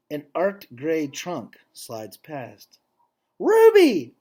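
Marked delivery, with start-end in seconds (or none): 3.4-4.1 very high, loud joyful call; exclaiming. Acted. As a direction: call out her name in delight. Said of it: "I exclaim".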